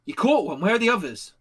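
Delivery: loud, high questioning bark; guard firing questions at a comrade; soldier's demand. Acted. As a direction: call out in surprise, then demand. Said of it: "he's like".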